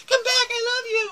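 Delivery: high mock-desperate wail; pleading visitor. Guide mimics visitors whose food ran out, high pleading wail.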